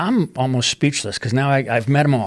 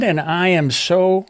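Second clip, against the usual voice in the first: ordinary talk, nothing marked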